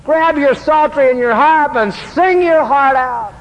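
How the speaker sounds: a touch of a coach rallying David; rousing self-talk. Retold or imitated. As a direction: cheer yourself up to sing. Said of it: "what he means by that probably is".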